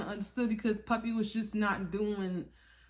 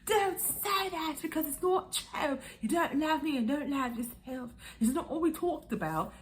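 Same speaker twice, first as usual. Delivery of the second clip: high-pitched mock upset rebuke; hammed-up protest; 8 semitones higher than usual. [name] rebuking Puppy, hammed-up high protest. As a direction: plead and scold in an exaggerated high voice.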